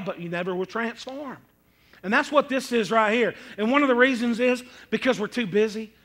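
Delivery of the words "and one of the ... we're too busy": usual speaking voice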